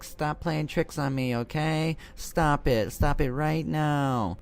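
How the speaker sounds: high-pitched imitation of a worried girl; hammed-up anxious scolding, slowing at the end; mock worried. Babysitter tells friends to stop the prank, mock worried. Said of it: "getting really worried".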